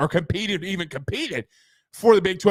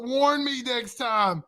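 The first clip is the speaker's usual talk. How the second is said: laughing; 4 semitones higher, slower than usual. Real, not acted. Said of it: "I'm literally in tears".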